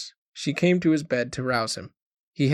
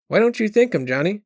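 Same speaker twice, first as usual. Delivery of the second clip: a mother urging her son, voice pitched up with worry; anxious mother; 8 semitones higher, 5 dB louder than usual. A narrator's reading. Mother nudging sick son to thank foreman, anxiously. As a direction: press him anxiously, high and a touch louder. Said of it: "his mother asked anxiously".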